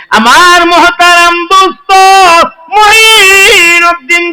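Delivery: high, loud sermon chant; impassioned high-pitched preaching; full-force preaching. Acted. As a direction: ring it out on a high sustained pitch.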